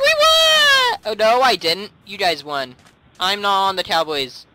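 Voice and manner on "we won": very high, loud victory yell; elated shriek; triumphant. Real, not acted. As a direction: whoop the win. Celebrating a supposed win.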